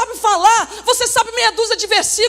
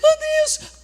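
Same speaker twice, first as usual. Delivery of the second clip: higher, wailing oh Deus, half-acted; mock crying; 6 semitones higher, 4 dB quieter, much slower than usual. Preacher acting Joshua crying to God, higher mock wail. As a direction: cry out to God like a grieving leader. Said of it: "Josué chorando".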